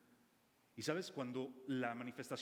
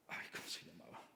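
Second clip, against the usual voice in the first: whispered to himself, searching memory; breathy unvoiced aside, trying to recall; 10 semitones lower, 8 dB quieter than usual. Real, not acted. Preacher forgetting a city name, whispering to himself.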